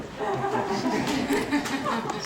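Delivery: bright, high burst of laughter; delighted high laugh. Real, not acted. Delighted at signed book.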